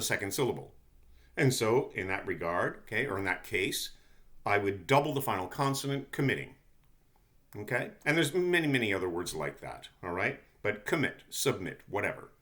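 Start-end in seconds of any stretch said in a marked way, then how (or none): none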